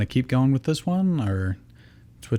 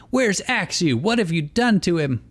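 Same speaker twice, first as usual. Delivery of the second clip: angry demand; 8 semitones higher than usual. Acted. Iris confronting Ash.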